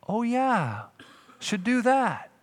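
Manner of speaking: a high, sheepish "oh yeah"; like someone caught missing the obvious; mock embarrassed afterthought; sheepish realisation. Sheepish congregant realising obvious answer.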